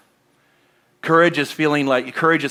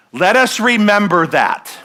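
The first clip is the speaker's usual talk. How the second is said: usual speaking voice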